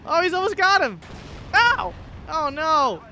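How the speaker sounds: loud, high excited yelling; excited shouting. Real, not acted. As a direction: shout it out in the heat of battle. Excited gaming moment.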